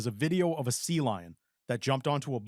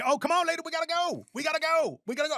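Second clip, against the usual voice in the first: high, urgent dubbed animal voice; frantic high-pitched imitation of a sea lion; talking sea lion; faster than usual. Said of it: "his video being the sea lion".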